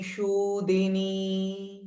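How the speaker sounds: Shudini with a retroflex sh and a long vowel, slow and soft. Shown as a demonstration. Teacher modelling the retroflex sh in Shudini. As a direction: curl the tongue back on the sh of Shudini. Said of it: "S with dot underneath".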